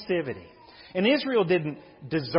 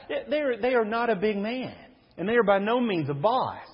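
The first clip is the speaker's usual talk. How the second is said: ordinary talk, nothing marked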